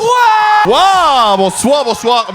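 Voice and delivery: shouted high greeting; hyped entrance. Acted. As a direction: burst in like a star arriving.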